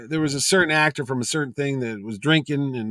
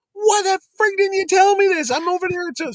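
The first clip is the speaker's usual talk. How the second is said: very high, loud protest; retold exasperated outburst; 5 dB louder than usual. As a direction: act out aggrieved disbelief, pitch shooting up. Retelling his exasperated outburst.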